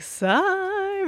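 high drawn-out call. Retold or imitated. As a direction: call his name high and sweet. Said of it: "I'll get to the point where I'm like".

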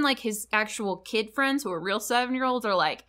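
usual speaking voice

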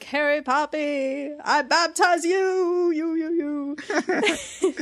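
high, slow booming proclamation; loud booming voice. Retold or imitated. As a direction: boom it up to the heavens. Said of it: "in this loud, booming voice looking up to the heavens".

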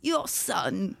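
soft, tentative copy of an impression; Walken. Shown as a demonstration. Pupil copying the coach's line, Walken impression. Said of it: "Try walking".

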